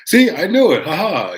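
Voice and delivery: sudden high, loud "I knew it"; triumphant burst of excitement; excited exclaim. Real, not acted. Guesses right, then exclaims triumphantly.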